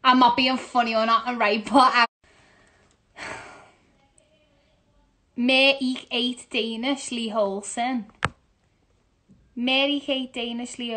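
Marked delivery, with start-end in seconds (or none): none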